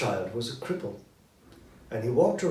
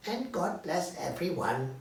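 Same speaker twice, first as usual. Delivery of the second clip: a hint of a frail little boy; weak child voice; 5 semitones higher than usual. A narrator's reading. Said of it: "said Tiny Tim in his weak voice".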